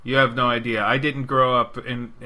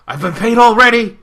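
quoted shouted line; 11 semitones higher, 13 dB louder than usual. Retold or imitated. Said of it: "He's got a really funny line".

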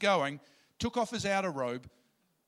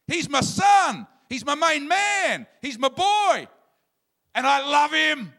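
joyful booming shout, much higher and louder; Father shouting; 10 semitones higher, 10 dB louder than usual. Retold or imitated. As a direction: proclaim it to the whole world at full voice. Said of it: "I hear the Father shouting".

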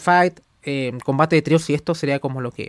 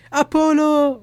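excited shout; 11 semitones higher, much slower than usual. Acted. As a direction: hype shout of a name. Calling out the wrestler's name.

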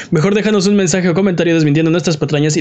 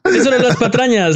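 higher, booming segment call; segment announcer; 7 semitones higher than usual. Acted. (anunciador de sección)

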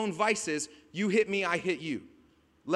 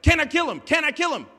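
higher and louder, itching to strike; eager demand; 7 semitones higher, 7 dB louder than usual. Retold or imitated. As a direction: beg for permission to kill. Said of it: "he says it twice, like".

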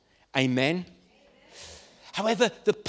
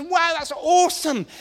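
amazed God; 10 semitones higher than usual. Retold or imitated. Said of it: "he looks and goes".

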